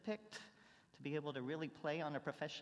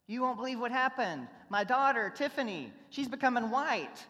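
louder, higher excited rush; excited news; 7 semitones higher, 9 dB louder than usual. Retold or imitated. Luis telling Gregory about his daughter, louder and higher. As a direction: burst in with amazing news. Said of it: "Luis finds his way into the house and says".

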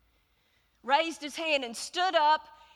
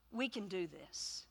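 quiet echo; 6 semitones lower, 12 dB quieter than usual. Retold or imitated.

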